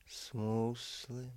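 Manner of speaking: ordinary delivery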